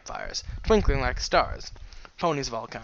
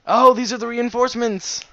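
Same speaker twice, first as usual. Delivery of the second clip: high, loud exclamation; excited realisation; 10 semitones higher, 9 dB louder than usual. Acted. Bystander hails the newcomers, loud and excited. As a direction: burst out with the discovery.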